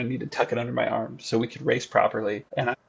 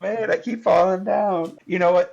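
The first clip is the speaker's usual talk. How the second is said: character voice; 7 semitones higher than usual. Acted. Character slipping on the ice.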